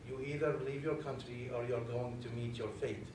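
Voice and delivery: ordinary delivery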